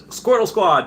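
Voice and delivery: high, loud cheer of the name; hyped chat shout-out; excited cheer. Acted. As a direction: shout it like a fan chant. Reading a chat cheer aloud.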